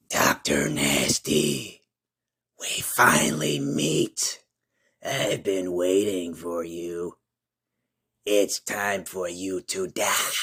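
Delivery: deep, slow, menacing monster growl; a slow, creeping villain greeting his foe; Krampus voice. Acted. Krampus speaks to the hero.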